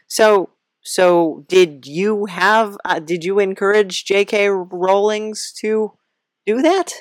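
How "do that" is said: ordinary talk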